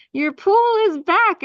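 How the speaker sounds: excited outburst; triumphant reveal. Real, not acted. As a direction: announce it like the big win.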